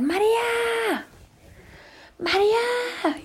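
high, slow drawn-out call for grandma; calling out María. Retold or imitated. Stuck in the bathroom, calling grandma by name, long high call. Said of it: "empecé a gritar".

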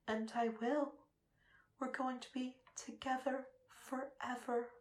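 soft murmur. Acted. Lover gazing at her partner, murmuring very softly. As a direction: murmur it close to his ear.

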